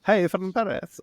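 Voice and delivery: put-on high, loud, failed French accent that slides toward Mexican; bad French accent. Cohost botches a French accent greeting. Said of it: "it's like a Mexican accent".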